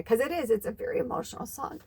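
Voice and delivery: choked, breathy voice on the edge of tears; tearing up. Real, not acted. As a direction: let the tears catch in the throat. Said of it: "I'm starting to cry".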